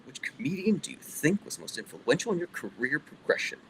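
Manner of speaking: mild Twilight Zone narrator impression; a hint of an old-time TV narrator; Rod Serling. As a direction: read the question like an ominous TV host intro. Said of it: "Rod Serling, can we hear a little?".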